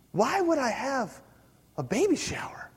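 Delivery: ordinary delivery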